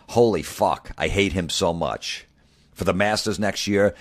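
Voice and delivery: ordinary delivery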